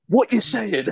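high-pitched taunt in jest; mock challenge. Co-host imagining standing over tackled man, mock challenge. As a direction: crow it over a floored opponent, jokingly. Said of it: "was like".

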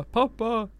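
put-on high, slow little-kid voice; imitated child. Child waking parent to report a Teletubby, imitated high kid voice. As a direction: call out slowly and high like a child at night. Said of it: "hör man sitt barn bara".

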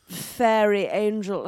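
very slow mock-grand announcement; Anton. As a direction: stretch it out like a pompous reveal. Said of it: "Anton always goes".

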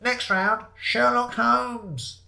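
high, stretched-out quizmaster call; amplified announcement. Acted. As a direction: announce the round over a microphone. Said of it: "as the amplified voice said".

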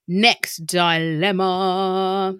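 very slow, long-held notes; sing-song announcement. Pretend. Podcast host moving on to the next question, sing-song announcement. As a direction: stretch it out like a jingle.

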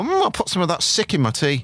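high mock-regal queen, savouring; hammed-up high queen; posh queen voice. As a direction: play the queen bee sipping tea. Voicing the queen bee.

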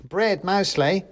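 high little matter-of-fact answer; mouse soldier. A narrator's reading.

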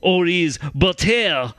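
put-on slow, hammy French Canadian accent. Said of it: "my French accent that I perfected".

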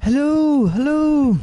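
very high, drawn-out falsetto hello; self-impersonation. Acted. Guest doing an impression of himself, high caricature. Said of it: "me doing an impression of myself".

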